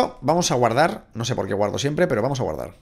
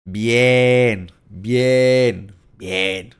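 loud repeated cheer; happy gamer cheer; excited cheer; 2 semitones lower, 5 dB louder, much slower than usual. Real, not acted. Celebrating in game.

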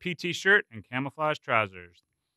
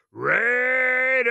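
shouting; 12 semitones higher, 5 dB louder than usual. A narrator's reading. Platoon shouting in unison.

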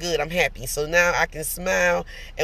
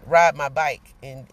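usual speaking voice

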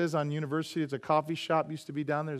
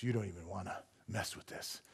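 low, soft ominous voice; spooky warning; 10 semitones lower, 16 dB quieter than usual. Retold or imitated. The spiritist warning the pastor, low soft menace. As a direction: warn him off in a low hush. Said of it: "he goes".

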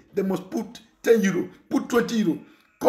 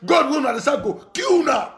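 angry shout; 6 semitones higher than usual. Real, not acted. Shouting at the fake pastors in anger. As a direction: yell it at them in fury.

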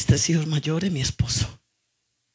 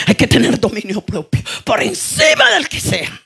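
building shouted praise; 10 semitones higher, 7 dB louder than usual. Acted. Preacher crying out praise to God before the church, building to a shout. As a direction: praise shouted higher and higher with each repeat.

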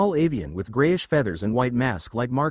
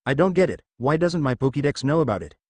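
ordinary delivery

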